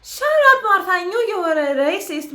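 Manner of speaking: loud, high telling-off; high, loud burst of indignation; outraged scolding. Real, not acted. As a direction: shout back at the screen in outrage. Scolding a character on screen.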